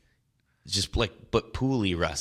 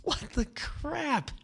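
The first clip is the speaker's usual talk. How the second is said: drawn-out, high-pitched incredulous squeal; retold reaction, hammed-up and squeaky slow; mock disbelief; much slower than usual. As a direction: stretch it out high in exaggerated bafflement. Retold stunned reaction to bad guitar.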